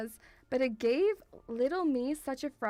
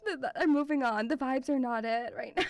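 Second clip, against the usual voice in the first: normal talking voice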